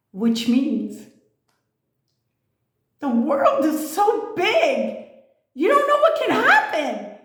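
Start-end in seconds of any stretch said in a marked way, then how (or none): 3.0-7.2 high, laughing mock-mom voice, slowed at first; imitating mom. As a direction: squeak it out like a giggly mother. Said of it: "my mom always said".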